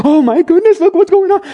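high, loud mock panic; panicking disciples. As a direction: squeal it like frightened disciples.

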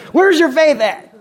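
high, loud acted rebuke; exasperated shout. Jesus rebukes disciples in the boat, exasperated shout. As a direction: fire it back at full volume.

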